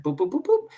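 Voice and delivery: high, short boops; imitated dial beeps. Marine retelling a speakerphone call, imitated beeps.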